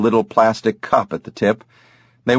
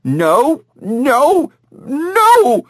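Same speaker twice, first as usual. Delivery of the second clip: rising cries, each higher; heavy hit cry; 4 dB louder, much slower than usual. A narrator's reading. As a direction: build the noes into a wail. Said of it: "even as she protested".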